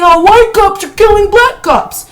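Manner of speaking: sneering mock voice of the people on the boxes; mocking quote. Ranting vlogger mocks the activists on boxes in a sneering voice. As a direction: parody them with a scoffing "Oh".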